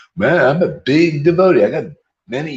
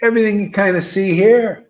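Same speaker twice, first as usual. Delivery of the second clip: higher, louder rousing call to the crowd; a preacher calling the crowd to join in; louder call again; 7 semitones higher, 4 dB louder than usual. Shown as a demonstration. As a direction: lift the room with it. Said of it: "One more time".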